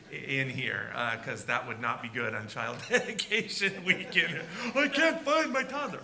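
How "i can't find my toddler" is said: high, loud panicked parent. Pretend. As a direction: play a parent who lost their kid. Parent panicking, acted quote.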